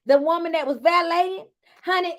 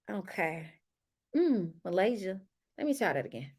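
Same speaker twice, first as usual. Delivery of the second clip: quiet aside; 7 semitones lower, 9 dB quieter than usual. Real, not acted. Low aside before retake.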